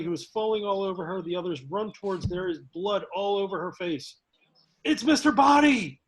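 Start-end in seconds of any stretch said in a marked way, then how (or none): 4.8-6.0 high, loud dramatic exclamation; alarmed cry. Acted. Character alerting the others to the body, alarmed cry. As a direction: call out the shocking discovery.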